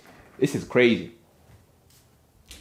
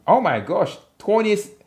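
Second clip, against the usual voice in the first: surprised exclamation; 10 semitones higher, 5 dB louder than usual. Real, not acted. Reacting to a viewer comment.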